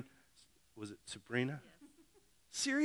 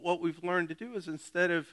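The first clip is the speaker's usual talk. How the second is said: ordinary talk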